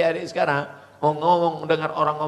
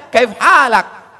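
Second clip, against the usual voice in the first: kaif halak said high, loud and very slow in Saudi style; Saudi Arabic; 9 semitones higher, 10 dB louder, much slower than usual. Shown as a demonstration. Preacher showing the Saudi greeting to listeners, loud and slow. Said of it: "Sampai ke Saudi Arabia".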